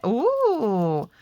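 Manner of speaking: long ooh that swoops up and falls; admiring ooh. Real, not acted. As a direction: coo in delight at a reveal. Admiring the stencil reveal.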